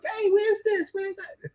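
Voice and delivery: high squealing auntie voice; squealing baby talk aunt. Retold or imitated. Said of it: "And auntie be like".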